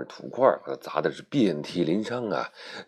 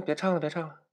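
character voice; 12 semitones higher, faster than usual. Acted. Character stops the singing.

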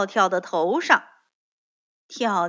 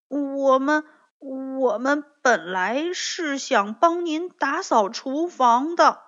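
put-on higher, hesitant child-character voice; falter, then explain apologetically, dragging the end; ashamed; 4 semitones higher, 3 dB louder than usual. A narrator's reading. Bengbeng and Tiaotiao confess to granny bear, ashamed and hesitant. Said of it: "羞愧地低下头说".

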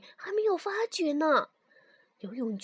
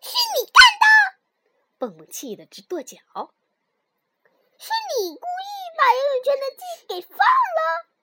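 high, loud furious accusation; angry accusation; 11 dB louder, faster than usual. Acted. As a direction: accuse him in outrage.

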